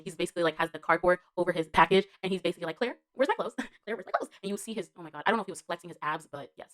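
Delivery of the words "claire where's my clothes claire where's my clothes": higher mock plea, with a giggle; imitated character's call. Fan imitating naked time traveler asking Claire, amused. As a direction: lightly imitate him calling out, amused. Voices the actor's line to Claire.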